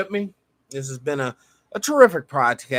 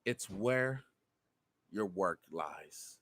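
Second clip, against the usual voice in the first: much softer, lower, slow and choked; emotional hush; 10 semitones lower, 17 dB quieter, slower than usual. Real, not acted. Host telling listeners where their work lies, emotional hush. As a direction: drop to a near whisper, overcome. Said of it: "Sorry about getting emotional".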